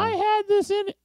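high put-on coworker voice, +22 st. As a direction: squeak it like a petty coworker. Coworker voiced over fridge food.

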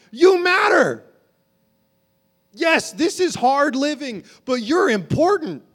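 high, loud, building preacher's cry; full-force preaching. Acted. Pastor urging the congregation that they matter, full-force preaching. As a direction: lift the room, pleading that they matter.

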